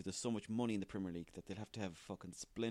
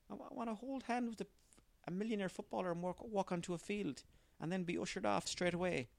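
put-on whiny kid voice; 9 semitones higher than usual. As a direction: play a kid pleading for his dream. Acted kid describes his dream.

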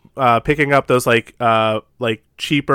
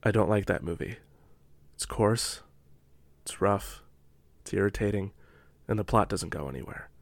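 much softer and lower, grave pauses; hushed deadpan review; 5 semitones lower, 13 dB quieter than usual. Acted. Reciting her Letterboxd review, hushed and solemn. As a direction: deliver each verdict like a solemn pronouncement.

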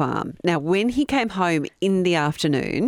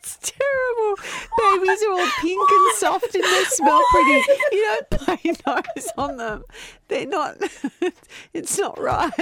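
high-pitched words broken by real laughter; fighting through the giggles; laughing fit. Real, not acted. Telling her co-host off while laughing, real laughter.

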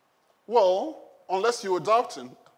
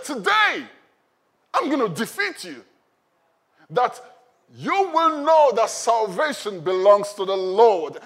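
loud, defiant battle declaration; angry; 3 semitones higher, 8 dB louder than usual. Acted. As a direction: proclaim it boldly as a champion facing his foe. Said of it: "He was angry in his spirit".

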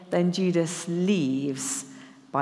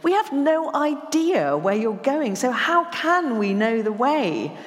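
high, loud and exasperated; 6 semitones higher, 3 dB louder than usual. Acted. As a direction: fling the question out in frustration. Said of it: "hear the exasperation in his voice".

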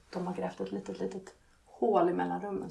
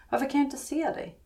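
put-on higher-pitched child's question, mildly raised; out loud; 7 semitones higher than usual. A narrator's reading. Small child asks through the hole, out loud. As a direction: ask it openly, lifting the pitch. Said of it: "säger rösten högt".

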